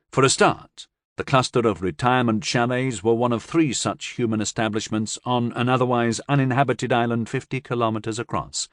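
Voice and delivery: usual speaking voice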